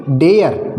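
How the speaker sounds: डेयर as 'de-yar'; correct two-syllable reading. Shown as a demonstration. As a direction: sound out both parts of डेयर, glide included. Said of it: "क्या बोलेंगे?". (डेयर को पूरा 'डे-यर')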